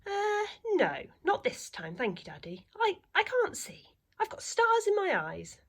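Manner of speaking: put-on high, hesitant little boy owl. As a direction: squeak it out like a small shy owlet. Little owl Plop voiced.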